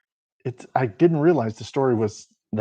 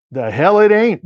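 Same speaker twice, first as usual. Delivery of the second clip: higher and louder, scoffing; indignant retort; 9 semitones higher, 6 dB louder than usual. A narrator's reading. Bertie scoffs at Gus's formula excuse, loud and high. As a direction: throw it back at him, offended. Bertie snaps back at Gus.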